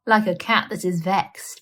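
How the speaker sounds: usual speaking voice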